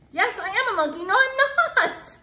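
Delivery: high, loud silly squeal; excited squeal. Acted. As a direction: squeal it like a giddy kid. Forced to read monkey lines.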